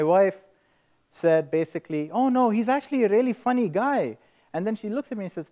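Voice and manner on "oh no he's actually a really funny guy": high-pitched, insistent reassurance; eager vouching. Retold or imitated. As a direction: imitate a wife talking up her partner. Wife vouching to her mother.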